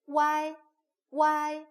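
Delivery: 와이 high and loud as one glide; model syllable. Shown as a demonstration. Teacher models 와이 for learners. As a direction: pitch 와이 up, say it clearly. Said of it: "이렇게 읽어주시면 돼요".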